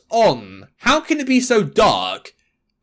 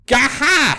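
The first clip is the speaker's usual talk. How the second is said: high strangled yelp; pained yelp; 7 semitones higher than usual. Acted. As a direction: yelp out in pain. Reacting to surgery pain.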